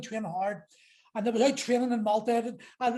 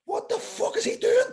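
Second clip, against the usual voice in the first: high, disbelieving cry; retold panic; 10 semitones higher than usual. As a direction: squeak it out in stunned alarm. Retold panic in kebab shop.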